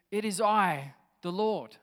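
loud, measured divine announcement; lightly voiced God, announcing himself loudly; voice of the Lord. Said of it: "this voice comes back and says".

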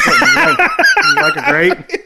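high squealing laughter through words; laughing. Real, not acted. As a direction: giggle the question out.

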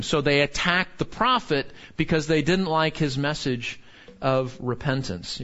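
ordinary talk, nothing marked

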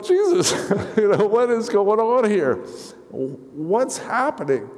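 retelling a helpless, laughing breakdown; laughing through tears. Retold or imitated. Retells weeping at wedding.

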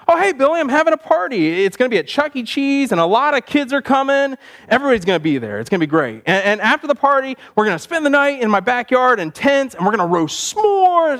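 put-on high-pitched boyish enthusiasm; excited kid pitch. Boy pitching his party to a classmate, excited. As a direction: sell the party with mounting thrills.

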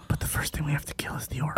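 unvoiced urgent whisper; fierce hushed whisper. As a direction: whisper the plan to the party. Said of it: "I'm still whispering".

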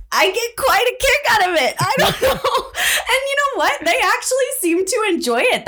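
high-pitched laughing delight. Real, not acted. Laughing about her old man skits.

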